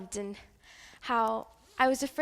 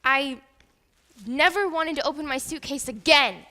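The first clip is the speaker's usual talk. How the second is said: louder, emphatic vow; raised voice after being hurt; hurt outburst; 2 semitones higher, 7 dB louder than usual. Acted. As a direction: burst out loudly, hurt and resolute. Hurt after her things were trashed.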